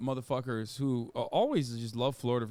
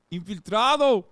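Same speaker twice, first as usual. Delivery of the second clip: Infiltrado shouted in a mock Brazilian accent, much higher and louder; 6 semitones higher, 11 dB louder than usual. Streamer laughing, mocks Brazilian accent on Infiltrado. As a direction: belt out Infiltrado like a Brazilian protester. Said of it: "I'm trying to do a Braz-, Brazil".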